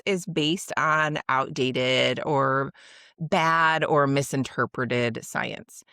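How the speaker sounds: outdated, bad and misinterpreted said slowly, each word weighed; slow emphasis. Real, not acted. Stressing that the science is bad.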